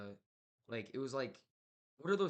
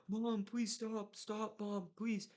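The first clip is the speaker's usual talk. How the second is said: much higher, slow, terrified begging; desperate pleading; 10 semitones higher, much slower than usual. Retold or imitated. Son begging his possessed mother in the attic, terrified high plea. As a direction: beg her to stop, voice strained high.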